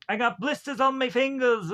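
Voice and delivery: high put-on British rocker cry. Podcaster throwing in a band impression, high put-on voice. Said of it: "uh, Beatles and whatever".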